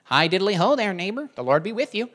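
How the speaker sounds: chirpy, sing-song neighborly greeting; lilting upward, folksy churchman caricature; Ned Flanders. Acted. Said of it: "a Ned Flanders thing".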